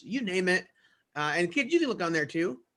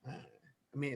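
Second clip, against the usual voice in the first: normal talking voice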